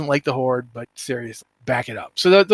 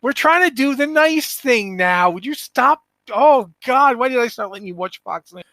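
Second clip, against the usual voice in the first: higher, whiny mock teen pleading with dad; embarrassed kid; 7 semitones higher than usual. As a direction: playfully voice a mortified child.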